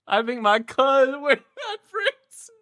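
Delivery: high, loud, breaking up; words collapsing into laughter; helpless cackling. Real, not acted. Cackles at the joke.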